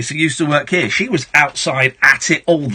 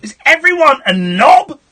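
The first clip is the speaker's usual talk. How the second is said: loud, high cry of exasperation; explode, fed up with callers; exasperated outburst; 11 semitones higher, 6 dB louder than usual. Real, not acted. Host fed up after prank call.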